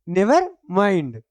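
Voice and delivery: "never mind" drawn out very slowly, each word spelled out clearly; slow repeat for the class. Shown as a demonstration. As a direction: dictate "never mind" slowly so pupils can copy it. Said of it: "क्या हुआ?".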